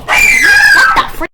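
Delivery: high startled yelp of disbelief; shocked shout. Real, not acted. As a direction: blurt it out in shock. Shocked by book content.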